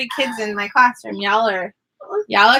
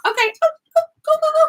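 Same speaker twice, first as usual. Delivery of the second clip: higher and more urgent each go; shooing urgently; 14 semitones higher, much faster than usual. Real, not acted. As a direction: hurry them out of the room. Shooing kids out.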